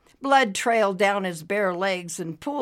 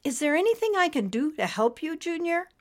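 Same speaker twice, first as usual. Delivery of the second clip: higher, quick, gentle offer; concerned helper; 10 semitones higher, faster than usual. A narrator's reading. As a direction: offer help timidly in a higher voice. Nimbus offers help to fallen Junior.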